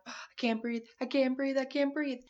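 gasping that she can't breathe; mock panic. Recalling her panic attack, rising breathless alarm. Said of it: "working myself up to the point of a panic attack".

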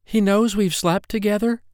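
almost yell it in a high, mortified voice; near yell. A narrator's reading. Mortified woman to her lover about being found out, almost yelling. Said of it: "she almost yelled".

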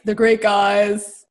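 loud, drawn-out jock parody; mock hockey player. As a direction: lightly poke fun at a hockey player. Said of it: "making fun of what hockey players say".

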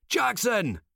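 urgent shout from a foxhole; calling out. A narrator's reading. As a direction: yell the name across the battlefield. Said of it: "he hears from a nearby foxhole".

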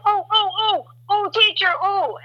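high-pitched pupil begging to be picked; high, excited classroom pleading; eager schoolchild. Acted. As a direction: squeal it like a kid with a hand up. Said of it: "someone to be like".

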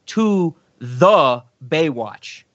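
"the" set apart, drawn out slowly before Baywatch; slow right down and lean on the word "the"; stress on THE. Retold or imitated. Quoting the movie character's odd article, slow stress on "the". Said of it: "that's how he says it".

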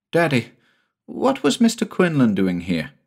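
put-on a child asking innocently; lightly voiced curious boy; small boy Jamie.

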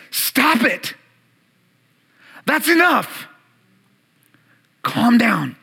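a hint of a stern parent, firm; with authority. Jesus scolding the winds and waves, authoritative. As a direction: tell the storm to stop, firmly. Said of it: "saying this with authority".

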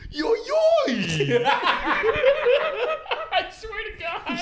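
squeaky high yo yo, laughing; overacted anime dub. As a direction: shriek it like a goofy anime sidekick. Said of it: "every time he talks, he goes".

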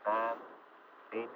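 normal talking voice

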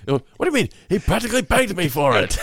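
loud, high mock outrage; retelling Morty's indignant reply; indignant shout. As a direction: shout it like an offended old man. Morty's indignant retort retold.

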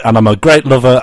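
ordinary delivery

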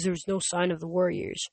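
usual speaking voice

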